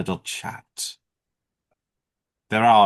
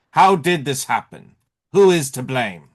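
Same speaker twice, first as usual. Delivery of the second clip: raised, louder rhetorical challenge; accusing questions; 5 semitones higher, 5 dB louder than usual. Acted. Speaker challenging London over who is to blame, accusing. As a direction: demand answers, higher and more forceful.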